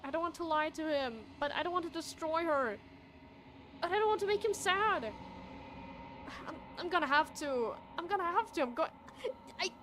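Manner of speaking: high, loud, torn protest; upset, voice pushed up high; distressed. Real, not acted. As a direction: agonize aloud over a hard choice. Torn over upsetting game character.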